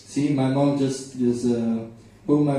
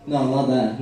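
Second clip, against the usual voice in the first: ordinary delivery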